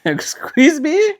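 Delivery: a high, slow, affronted excuse me; a sassy lady taken aback by a rude remark. Pretend. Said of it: "I haven't heard that one".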